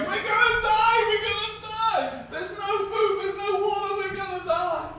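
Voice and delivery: high, loud panicked shrieking; panicked screaming. Acted. Shipwrecked man screaming at his calm friend, panicked shriek. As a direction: scream it like a man sure he'll die. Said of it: "started screaming and yelling".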